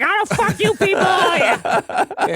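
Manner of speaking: high, drawn-out mock outburst; hammed-up squealed protest. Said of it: "Timmy's like".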